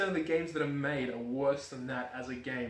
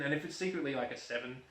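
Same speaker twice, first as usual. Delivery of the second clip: ordinary talk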